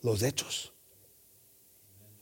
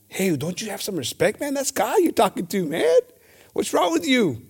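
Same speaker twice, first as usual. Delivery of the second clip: rising, higher scolding; the good thief scolding the other thief, indignant and higher; rebuking thief; 4 semitones higher than usual. Acted. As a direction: scold him, climbing up in pitch.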